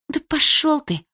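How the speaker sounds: high-pitched defiant outburst; scornful, heated comeback; indignant retort. Acted. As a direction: tell him off, pitch shooting up. Girl snaps back in quarrel.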